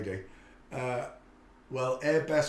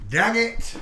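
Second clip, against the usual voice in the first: frustrated outburst; 11 semitones higher, 11 dB louder than usual. Real, not acted. Annoyed at forgetting pet.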